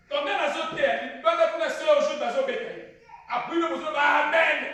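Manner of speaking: loud preacher cry; fired-up preaching. Acted. Preacher telling the church about an attacker, loud preaching. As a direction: preach it out hard to the hall.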